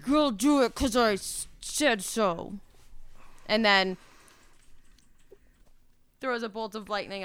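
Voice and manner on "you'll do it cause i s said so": petty tyrant snapping an order; bossy, spoiled villain; mean voice. Acted. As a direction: Genevera ordering the prisoner in a mean voice. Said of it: "puts her mean voice back on".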